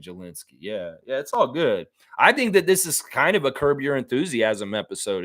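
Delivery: ordinary delivery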